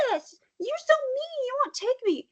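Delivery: put-on high whining kid voice; whiny child. Mom imitating a child denied the park, whining. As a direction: play the upset child begging to go.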